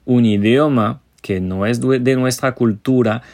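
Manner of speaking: normal talking voice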